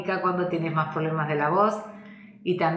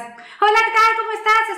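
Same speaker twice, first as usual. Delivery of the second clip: very high, loud, thin squeaky voice stuck in the head; head voice demo; 9 dB louder than usual. Shown as a demonstration. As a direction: squeak it out way up high, disconnected from the chest. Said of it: "escuchamos voces como estas". (demostración de voz de pito, muy aguda y fuerte)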